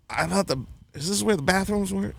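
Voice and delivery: put-on quick, quiet Boston accent, feigning drunk. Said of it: "whatever Boston accent".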